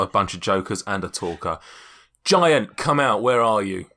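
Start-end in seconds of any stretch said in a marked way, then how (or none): 2.2-3.9 loud, raised call; shouting. Acted. Stranger calling for the giant in the castle, loud shout. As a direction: shout out for someone hidden, starting high. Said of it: "he shouts".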